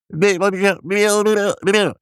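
high burbling babble; bubbling gibberish cry. A narrator's reading. Bubbling doctor tries to answer the nurse, babbling. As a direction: burble wordlessly at a high pitch. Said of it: "riep de dokter".

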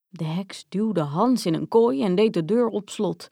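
ordinary delivery

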